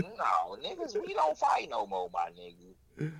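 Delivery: ordinary talk, nothing marked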